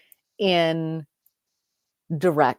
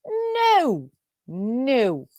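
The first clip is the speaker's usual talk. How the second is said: exasperated no; 5 semitones higher, much slower than usual. Real, not acted. Video player jumps the wrong way.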